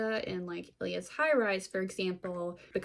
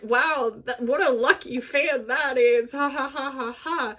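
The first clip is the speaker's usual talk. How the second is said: loud, higher announcer voice with forced laugh; sports announcers; 5 semitones higher, 7 dB louder than usual. Retold or imitated. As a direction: play a cheery, oblivious commentator. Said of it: "all the announcers are like".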